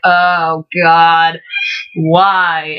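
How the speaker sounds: mock despairing wail, drawn out; retold crying wail. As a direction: drag out each word in a half-hearted wail. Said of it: "I'm crying so loud".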